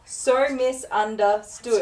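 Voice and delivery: slow exaggerated reading. Shown as a demonstration. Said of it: "It does say".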